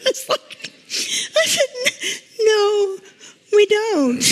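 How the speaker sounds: choked up with fond laughter; real laughter mixed with tears over a precious memory; laughing through tears. Real, not acted. Laughs and cries retelling story.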